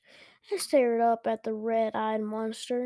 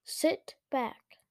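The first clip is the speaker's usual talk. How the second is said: plain reading voice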